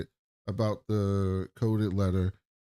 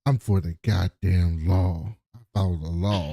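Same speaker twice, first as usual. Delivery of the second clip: acted emphatic declaration; 5 semitones lower than usual. Retelling a lawman declaring his loyalty, emphatic. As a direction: drop the pitch and weigh out each word.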